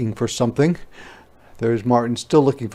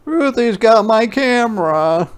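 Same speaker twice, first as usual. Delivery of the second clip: put-on high, louder boy's complaint; a boy telling on her; high loud tattling boy; crying out Martin; 11 semitones higher, 6 dB louder than usual. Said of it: "cried Martin".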